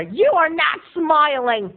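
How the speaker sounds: shrill boss screaming at her employee; angry costume designer yelling at the Snow King; screamed scolding. Retold or imitated. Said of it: "she screamed out of nowhere".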